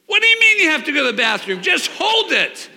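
put-on high, loud exasperated yell at a child; impatient angry shout. Father snapping at his daughter in the warehouse, loud angry shout. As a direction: bark it out like a fed-up dad. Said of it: "godly man that I'm not".

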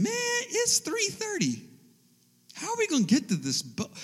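very high, squeaky mock panic; hammed-up alarm, voice shooting up high; retold panic. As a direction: act out dawning panic at the clock. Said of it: "And we're panicking".

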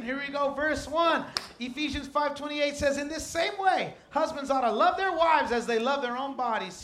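full-force preacher building on "wives"; preaching. Acted. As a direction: preach it out to the tent.

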